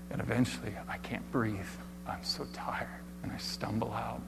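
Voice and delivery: breathless and drained, near a whisper; barely getting the words out; exhausted. Acted. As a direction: run out of air, spent. Exhausted collapse in the room.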